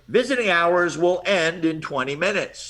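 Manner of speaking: slow Brooklyn PA announcement; Brooklyn accent. Retold or imitated. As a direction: mimic a Brooklyn hospital intercom voice. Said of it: "Worst Brooklyn accent you can think of".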